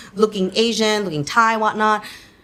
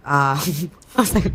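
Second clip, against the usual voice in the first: ordinary talk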